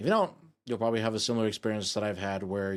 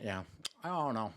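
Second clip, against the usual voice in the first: ASMR; 8 dB quieter than usual. Real, not acted. ASMR continued.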